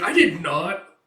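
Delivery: mock panicked protest; flustered denial. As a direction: deny it fast and loud. [name] denying in a panic.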